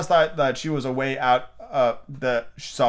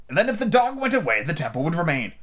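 high start, falling to a sure finish; triumphant; 6 semitones higher than usual. A narrator's reading. Red Queen winning the dog argument, triumphant. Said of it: "exclaimed triumphantly".

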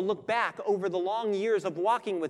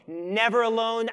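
louder, with a strong stress on Never; loud emphatic promise; 5 semitones higher, 7 dB louder than usual. Acted. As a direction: proclaim it like an unbreakable vow. Preacher voices Jesus promising Paul.

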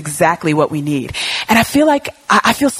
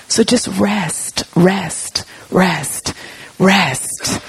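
slow, gentle repeated rest; soothing rest; much slower than usual. Retold or imitated. God soothing the anxious speaker, slow and gentle. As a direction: lull her to calm, slowly.

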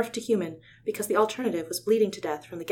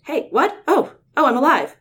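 much higher, louder startled cry; startled waking; 7 semitones higher, 12 dB louder than usual. Acted. Stunned hawk wakes and finds himself alive, high and loud. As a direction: jolt awake in surprise. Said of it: "Tobias ruffled a wing and said".

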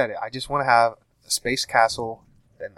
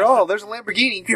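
mock excitement; 9 semitones higher, 3 dB louder than usual. Mocking racing game player.

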